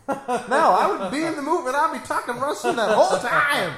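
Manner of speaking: loud, high laughing talk. Real, not acted. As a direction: laugh through the words. Laughing while talking excitedly.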